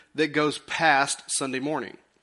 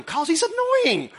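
mock high whine; 12 semitones higher, 3 dB louder, slower than usual. Mocking high punchline.

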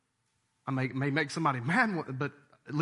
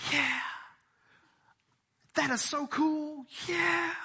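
mock pleased; 12 semitones higher, slower than usual. Listener's pleased reaction mocked.

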